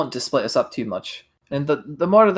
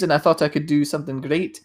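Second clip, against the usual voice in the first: ordinary delivery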